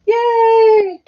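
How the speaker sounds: high, loud burst of relief; real little yay of relief; relieved cheer. Real, not acted. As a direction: cheer because it isn't broken. The dropped light still works.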